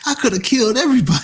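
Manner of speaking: high, fast boast; mock bravado. Dreamer bragging after waking, high fast mock boast. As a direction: rattle it off in mock triumph.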